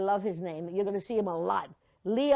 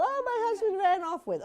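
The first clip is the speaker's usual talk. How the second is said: high, fluttery wail; hammed-up swoon, much higher; mock insipid wife; 10 semitones higher than usual. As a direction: parody a helpless movie wife. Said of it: "there'll be no insipid little".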